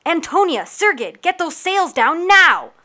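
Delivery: loud, high order, slowing on the last words; shouted orders. Acted. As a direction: bark the command across the deck. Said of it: "Captain Yuki shouted over the din".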